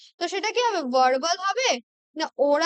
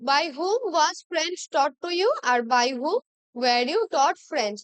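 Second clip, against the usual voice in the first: slow dictation; much slower than usual. Shown as a demonstration. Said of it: "দু রকম ভাবে".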